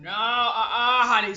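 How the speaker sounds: laughing refusal. Retold or imitated. Voicing refusal while laughing.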